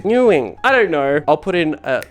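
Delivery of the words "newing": "Newing" said as NEW-wing, high on the first part; mispronunciation. Shown as a demonstration. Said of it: "I'm not even saying it correctly".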